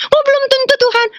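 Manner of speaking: put-on high-pitched childlike outcry to God; childlike reply. Replying to God's 'not yet', in high childlike voice. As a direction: answer in a high, small-child wail.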